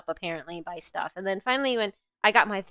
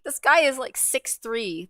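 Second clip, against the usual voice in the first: usual speaking voice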